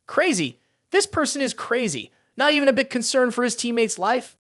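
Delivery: plain reading voice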